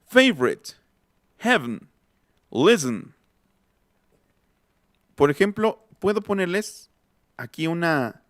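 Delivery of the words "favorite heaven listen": 'Favorite, heaven, listen' as FAV-rit, HEV-n, LIS-n; each word apart, unstressed vowels swallowed in 'Favorite, heaven, listen'; reduced vowels. Shown as a demonstration. Teacher repeating for listeners, 'Favorite, heaven, listen' with weak vowels dropped. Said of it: "Es, soy yo".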